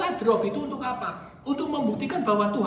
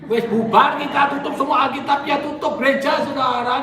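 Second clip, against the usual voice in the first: loud mock declaration, everything closed; louder hammed-up proclamation; mock announcement; 4 semitones higher, 8 dB louder than usual. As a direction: pretend God calling it all off. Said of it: "Atau Tuhan ngomong".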